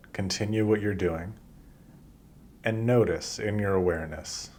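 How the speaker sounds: usual speaking voice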